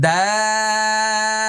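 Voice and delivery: দাল held very long, lips unrounded; very slow দাল demo; drawn-out dal. Shown as a demonstration. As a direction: stretch দাল out slowly. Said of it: "মুখটা দেখেন কিভাবে করছে".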